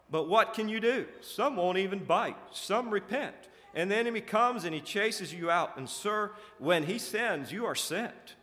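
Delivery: high, loud, hammed-up pleading; pleading excuse. A narrator's reading. Carnal excusing failure to the prince, pleading. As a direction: protest helplessly to a master.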